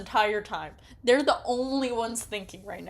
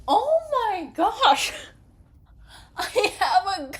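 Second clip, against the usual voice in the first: high, loud, laughing disbelief; shocked outburst; 12 semitones higher, 8 dB louder, slower than usual. Real, not acted. Gamer reacting to the story scene, shocked and laughing. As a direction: burst out in disbelief.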